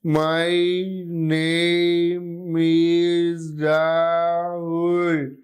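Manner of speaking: extremely slow, drawn-out syllables on one sustained tone; scanning speech. Shown as a demonstration. Lecturer imitating a patient's scanning speech, syllables dragged out very slowly. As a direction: stretch and separate every syllable evenly. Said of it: "There will be a scanning type of speech".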